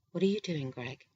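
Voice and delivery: plain reading voice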